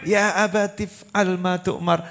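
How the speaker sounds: slow, soft chanted Arabic recitation; Quranic recitation. Acted. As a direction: recite the verse slowly and reverently. Quran verse quoted in sermon. (lantunan ayat pelan dan khidmat)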